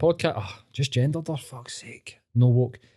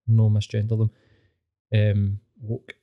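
ordinary talk

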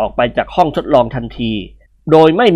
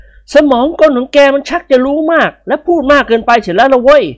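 higher, irritated voice; annoyed complaint; 11 semitones higher than usual. Acted. Pol complaining to the doctor about his machine, irritated. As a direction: grumble at the doctor. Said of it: "ร้องออกมาดังๆ".

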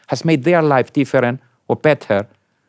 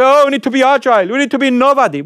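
higher, louder, quick mock boss talk; mock corporate slogan; 11 semitones higher, 3 dB louder, faster than usual. Manager preaching agility to staff, mocked, high and quick. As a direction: parrot the empty slogan in a mocking, quicker voice. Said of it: "You go and say".

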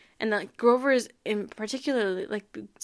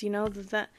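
ordinary talk, nothing marked

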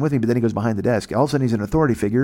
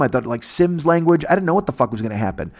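ordinary talk, nothing marked

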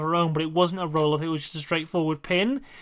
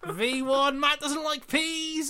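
high-pitched hammed-up entrance call; mock hype; 8 semitones higher, much slower than usual. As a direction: draw it out high, like a silly wrestling hype man. Hyped wrestler entrance catchphrase, playing along.

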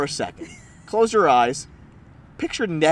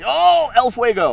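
high, drawn-out burst of recognition; high-pitched eureka; excited realization; 8 semitones higher, 4 dB louder than usual. Real, not acted. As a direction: cry out as the name comes back. Excitedly recalling the name.

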